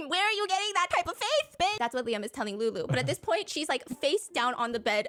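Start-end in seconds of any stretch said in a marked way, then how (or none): 0.0-1.8 incredulous mock sass. Mocking retort, high-pitched laugh first.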